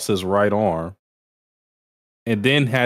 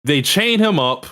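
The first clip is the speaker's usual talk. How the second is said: ordinary talk, nothing marked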